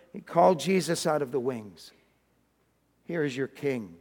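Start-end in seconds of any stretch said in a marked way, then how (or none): 3.0-4.0 low, soft presentation; resigned. Acted. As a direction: offer him up in defeat. Beaten Pilate presents Jesus.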